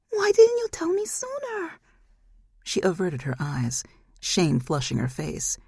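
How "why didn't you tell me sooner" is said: very high, otherworldly demand; very high eerie demon voice; demon mother's voice. Acted. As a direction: press her like a wounded demon.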